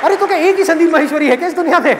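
high, loud mock retort through a laugh; indignant daughter. Daughter snapping back at her father, high and laughing. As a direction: snap back with comic outrage.

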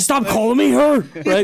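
high, loud laughing yell; laughing protest. Real, not acted. Podcaster objecting to friends' teasing, laughing shout.

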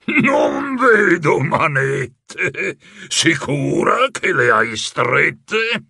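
laughing slowly through the taunt; mocking laugh. A narrator's reading. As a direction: gloat with a villain's laugh. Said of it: "Alzò in aria le mani e rise". (l'illusionista ride beffardo di Rebella)

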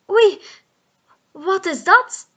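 high, loud startled cry; surprised. Acted. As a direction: gasp it out as the ball strikes. Ball hits the post.

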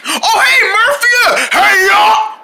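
high, excited holler; shouted greeting. Acted. Friends hail Murphy as he arrives, high shouted greeting. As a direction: holler a big hello.

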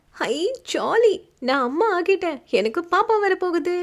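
overjoyed cry; thrilled mother-to-be shouting the news; excited shout. Acted. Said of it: "கத்தினாள்".

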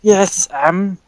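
put-on high, hesitant old lady; old woman slowly naming a price, drawn out at the end; old lady shopkeeper. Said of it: "burp in the old lady voice".

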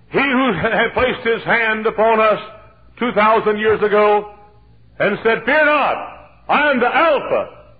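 high, loud preaching at full force. Acted.

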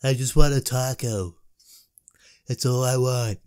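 lightly voiced Mexican-accented boss; mock Mexican Trump. Gamer voicing the boss as Mexican Trump, mock accent. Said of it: "It's like Mexican Donald Trump".

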